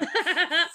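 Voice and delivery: squeaky high laugh; giggling at the memory; high giggle. Real, not acted. Laughing at own drunk story.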